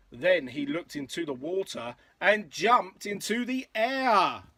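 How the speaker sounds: lilting, rhythmic picture-book read; story narration. A narrator's reading. Parent reading a dinosaur rhyme to a child, story narration. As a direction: read in bouncy verse rhythm.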